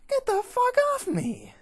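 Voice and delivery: high, loud outraged scream; shrieked protest, full force; furious shout. Acted. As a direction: scream at the guards holding you. Fox woman fights off guards.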